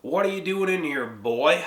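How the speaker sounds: high, loud mock yell across a bar; hostile yell. A stranger yelling at a young man across the bar. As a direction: call him out from across the room. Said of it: "someone yells".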